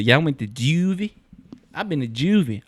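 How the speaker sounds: lightly put-on boastful kid; imitated Baltimore kid. Retelling the kid bragging about juvie, imitated kid voice. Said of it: "I like how that one kid said".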